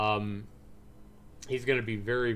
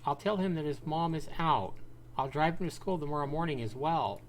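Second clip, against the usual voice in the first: woman neighbor voice; 7 semitones higher than usual. Acted. Neighbor offering to mind the boy, gentle high woman's voice. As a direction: play the kindly neighbor woman, helpful.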